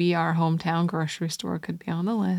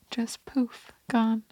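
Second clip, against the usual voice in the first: spooky whisper; 5 semitones higher, 7 dB quieter, slower than usual. Acted. Telling ghost vanishing, spooky beat.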